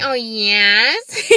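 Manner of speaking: loud, put-on weird answer. Shown as a demonstration. Woman confirming her name on the phone, weird voice. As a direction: exaggerate your own odd phone voice. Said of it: "It sounds, like, really fucking weird".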